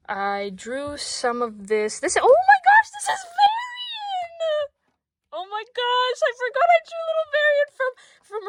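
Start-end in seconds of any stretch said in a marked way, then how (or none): 2.0-4.7 high, loud delighted shriek; excited squeal. Real, not acted. Vlogger rediscovering her drawing, squealing with delight. Finds forgotten drawing of Varian. 5.3-8.5 high, giddy gushing; excited. Real, not acted. Vlogger still gushing over her old drawing.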